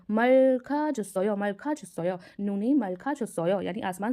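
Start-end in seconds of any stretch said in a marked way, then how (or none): 0.1-1.3 syllables joined in one slow line; slow blended reading. Shown as a demonstration. Teacher drawing out the phrase for students, slowly. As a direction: read Milkha छसो यो slowly, all blended together. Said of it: "हम सबको मिला के पढ़ेंगे".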